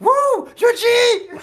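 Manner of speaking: whooping very high and loud; stretched whoop, laughing; wild whoop. Acted. Player hypes up drugged character.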